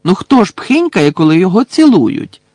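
loud, high exasperated question; incredulous and worked up; indignant retort. A narrator's reading. Indignant Ron retorts in argument.

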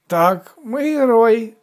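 put-on high, loud drunken mumble; tipsy young man's loud boast; drunken slurring. A narrator's reading. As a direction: slur it with an unruly tongue. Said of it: "неслухняним язиком промимрив позаду нього".

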